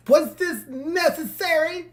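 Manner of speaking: high, slow exasperated question; stretched-out disbelief. Real, not acted. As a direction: let the frustration out slowly. Opening outburst, frustrated.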